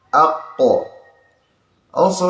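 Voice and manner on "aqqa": aqqa with the q held back tight; restrained qaf. Shown as a demonstration. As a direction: stop the voice hard on the q of aqqa. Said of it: "to restrain, not flowing out".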